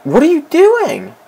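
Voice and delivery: very high, drawn-out protest; exasperated outcry. Real, not acted. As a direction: cry it out slowly in high-pitched disbelief. Exasperated at teammate.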